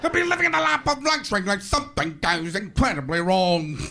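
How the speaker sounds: hammed-up announcer impression breaking into laughter; Robin Leach. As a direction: gush like a showy host cracking up. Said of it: "Robin Leach isn't laughing when he says".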